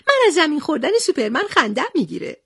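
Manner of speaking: high, quick little character voice; Nokhodi's voice. Acted. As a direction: pitch it high and rattle it off amused.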